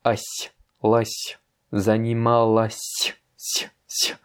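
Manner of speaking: "занималась" ending in a softened sy; voiceless "си" with only a faint glide; soft final s. Shown as a demonstration. Teacher isolates the ending for a learner, soft s. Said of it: "tu vois que ça fait si à la fin".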